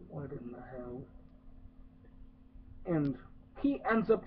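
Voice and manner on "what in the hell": low, much softer, muttered under the breath; quiet stunned aside; baffled mutter. Retold or imitated. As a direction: mutter it in disbelief. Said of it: "And I'm like".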